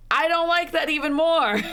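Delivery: high, loud outburst of disgust. Real, not acted. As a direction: recoil from it, voice jumping up. Reacting in disgust to the game.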